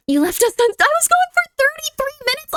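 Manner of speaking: high put-on cat voice; voicing the cat. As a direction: speak as the cat scolding owner. Voicing the cat's complaint.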